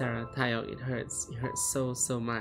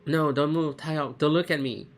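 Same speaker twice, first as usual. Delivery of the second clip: raised, pleading cry; desperate plea; 4 semitones higher, 6 dB louder than usual. Acted. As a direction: beg him not to look. Girl begging her friend.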